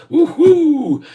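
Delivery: high, loud whoop of joy; excited whoop. Real, not acted. Whooping to the chat over the stock. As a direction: cheer it out, pitch jumping up.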